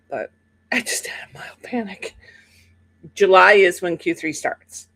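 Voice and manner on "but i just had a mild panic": whispered. Real, not acted. Confessing panic in whisper.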